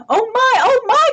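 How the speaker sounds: high, loud fretful cry; the farmer suddenly remembers, in a fluster; loud flustered exclamation, fast. Acted. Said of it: "cried the farmer".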